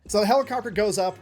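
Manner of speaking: ordinary talk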